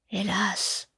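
soft, held-back sigh, a hint of reluctance; lightly voiced hunchback, sighing softly; hesitant sigh. A narrator's reading. Hunchback hesitating before confessing to the girl, softly. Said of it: "comme hésitant à achever".

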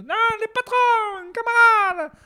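very high, loud, squeaky call to comrades; rally the troops in a tiny high voice; high squeaky union leader shouting; squeaky rallying cry. Retold or imitated. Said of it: "le mec qui est comme ça".

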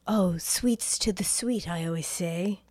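eerie whispered menace from the mother; creepy whisper. Retold or imitated. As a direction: breathe it slowly, like a sinister secret. Creepy mother gives Tanya rose.

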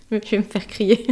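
laughing while talking. Real, not acted. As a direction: giggle through the words. Laughing at grandmother's reply.

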